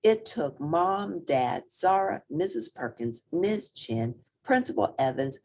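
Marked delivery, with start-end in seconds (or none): none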